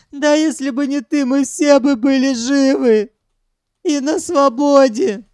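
high, loud tearful wail; sobbing harder. A narrator's reading. Captive lamenting lost life and freedom, sobbing. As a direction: wail the reproach through tears. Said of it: "зарыдал ещё сильнее Санёк".

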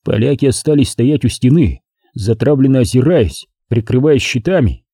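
ordinary delivery

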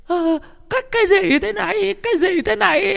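put-on very high-pitched wailing old woman; shrieking cry. A narrator's reading. Old woman splashed with chicken blood, shrieking tearfully. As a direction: wail it in a piercing high voice. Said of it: "Bà hai khóc ré lên".

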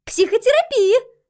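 put-on high, loud bossy child; authoritative girl. A narrator's reading. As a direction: proclaim it like a little expert. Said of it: "авторитетно заявила Айрис".

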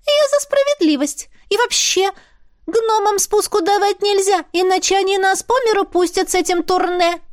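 high, squeaky little spirit, businesslike. Acted. Spirit talking business with the heroine, high squeaky voice. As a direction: pipe it up like a small sprite.

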